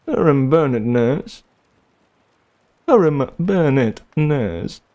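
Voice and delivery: a hint of a British accent. Shown as a demonstration. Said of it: "try my name in a British accent".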